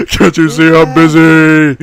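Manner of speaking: loud shout. Real, not acted. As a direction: scream it with full force. Louder repeat.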